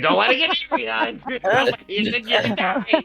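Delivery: high-pitched, excited goblin squeal; playful, panicky high warning; frantic mock warning. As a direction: squeal it as if waving something dangerous at them. Goblin waves grub, teasing party.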